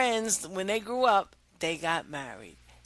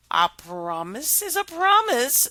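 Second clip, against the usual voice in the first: queen voice; 6 semitones higher, 4 dB louder than usual. Acted. Queen scolds princess.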